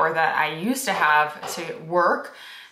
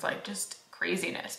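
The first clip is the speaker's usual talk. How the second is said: breathless whisper of a worn-out parent; whispered exhaustion; 12 dB quieter than usual. Retold or imitated. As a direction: whisper it, drained. Exhausted newborn parent quote.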